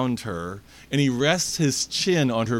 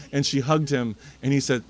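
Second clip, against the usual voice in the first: ordinary delivery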